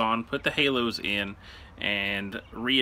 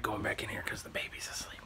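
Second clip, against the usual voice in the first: whispering over a crib; 9 dB quieter than usual. Real, not acted. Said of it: "I gotta be real quiet".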